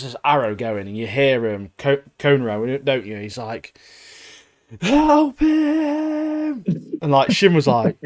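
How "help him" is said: high, drawn-out imitation shout; mock cry for help. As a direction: call out high and long, imitating a distant cry. Acting out distant cry for help.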